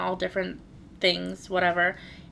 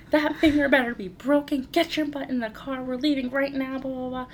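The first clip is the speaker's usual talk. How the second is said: higher, firm mother ordering; stern scolding; 7 semitones higher than usual. Retold or imitated. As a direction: order your son into the car. But I was like.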